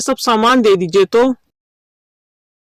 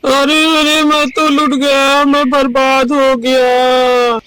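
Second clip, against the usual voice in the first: wailing lament; 3 semitones higher, slower than usual. Acted. Villager crying to neighbours that he is ruined, wailing. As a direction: draw each phrase out into a sobbing cry. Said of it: "क्यों रो रहे हो".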